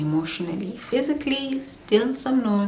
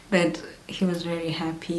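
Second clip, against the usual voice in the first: ordinary talk, nothing marked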